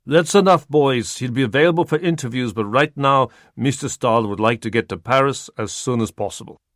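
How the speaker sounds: put-on loud, brisk Eastern European accent cutting in; firm Eastern European accent. Zolly ending the interview, firm Eastern European accent. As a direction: shut the reporters down firmly.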